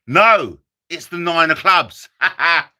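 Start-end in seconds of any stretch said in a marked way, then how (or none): none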